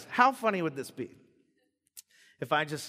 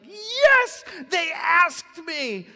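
much higher, louder, slow delighted cry; overjoyed Jesus; 13 semitones higher, 9 dB louder, much slower than usual. Retold or imitated. Jesus finally asked by his disciples, jubilant. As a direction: stretch it out in relieved joy. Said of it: "finally he's like".

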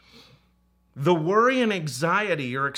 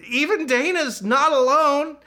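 much higher and louder, playful punchline; playful exclamation; 11 semitones higher, 6 dB louder than usual. Real, not acted. Pastor teases his wife about snoring, high playful exclamation. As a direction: burst out with a teasing cry.

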